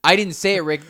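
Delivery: high, loud frantic pleading; loud protest. Real, not acted. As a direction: shout down the accusation. Heated recording argument.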